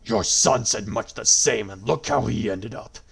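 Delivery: booming, mocking god voice; gloating villain. A narrator's reading. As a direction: sneer it down from on high. Villain taunts.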